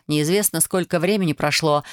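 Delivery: ordinary delivery, nothing marked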